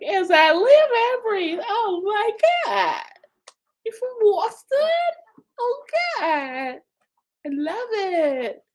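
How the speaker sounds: very high, drawn-out mock Boston accent. Streamer recalling a summer of talking like a Bostonian, mock Boston accent. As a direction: put on a hammed-up Boston accent. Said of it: "they had me talking like I was from Boston".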